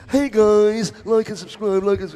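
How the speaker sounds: loud, high hyped YouTuber hype; over-the-top like and subscribe plea. Acted. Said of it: "that was my YouTuber voice".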